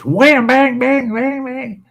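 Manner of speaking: high-pitched banging noise imitation; imitated mower clanging. Man retells his mower deck breaking, imitated clanging. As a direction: make the words clang like breaking machinery. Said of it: "all of a sudden I heard this".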